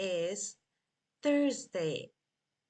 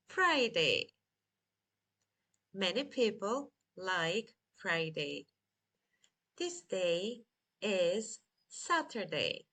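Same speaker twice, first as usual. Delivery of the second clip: ordinary talk, nothing marked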